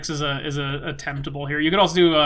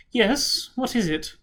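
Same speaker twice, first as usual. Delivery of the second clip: noble lady voice; 7 semitones higher, slower than usual. Acted.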